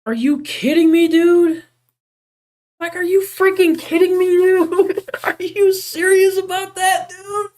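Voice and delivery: squeaky outraged disbelief, way up high; high-pitched disbelief. Real, not acted. Streamer to chat after a crash, shrill disbelief. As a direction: voice cracking up in shock. Streamer's work lost, outburst.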